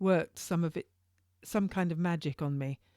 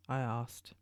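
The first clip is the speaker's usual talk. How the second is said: ordinary delivery, nothing marked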